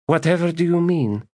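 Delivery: high, arch question; feigned innocence. Acted. Lady feigning innocence to the magus, arch and high. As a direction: play innocent.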